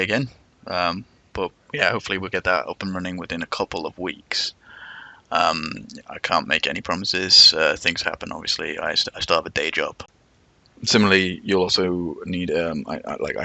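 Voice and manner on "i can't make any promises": ordinary talk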